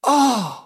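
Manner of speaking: high, loud gasp of horror; loud, falling exclamation of horror; horrified cry. Acted. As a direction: cry out in shock at a ghastly sight. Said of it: "s'exclama Beautrelet avec horreur".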